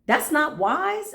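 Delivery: high, slow scolding with wise rising; a mock-shocked "That's not wise." rising on wise; incredulous. Shown as a demonstration. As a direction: lift "That's not wise." up in disbelief, slowly. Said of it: "I ain't feeding that".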